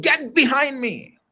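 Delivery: high-pitched, forceful sermon delivery; emphatic preaching. Real, not acted. Preacher insists the leader knew his onions, emphatic preaching. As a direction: drive the point home, pitched high.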